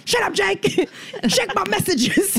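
high, dismissive boss brushing off her assistant, laughing through it; bossy boss. Pretend. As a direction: snap at the assistant, half laughing. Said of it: "And I'm like".

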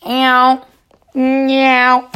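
high, loud meow noise; drawn-out meow. Acted. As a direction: stretch out a loud cat-like yowl. Imitated noise in the story.

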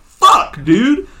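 sudden high, loud curse; retold burst of frustration; pissed. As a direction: explode on the first word, exasperated. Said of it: "and I'm pissed".

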